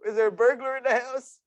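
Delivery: dad retelling his alarmed reaction to the shouting; mock alarm. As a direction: sound woken up and spooked.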